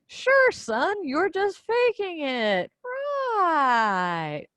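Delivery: mother to son, sarcastic disbelief; sarcastic mom. Retold or imitated. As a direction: play along, clearly not believing him. Mother doubting son's claim.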